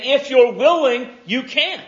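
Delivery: high, emphatic declaration of faith; confident plea. Acted. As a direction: say it with full conviction, voice lifted. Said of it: "his words convey a confidence".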